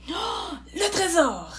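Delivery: slow, high gasp of awe; ecstatic wonder. A narrator's reading. Friends finding the treasure, ecstatic wonder. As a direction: marvel with drawn-out amazement. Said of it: "s'extasièrent tous".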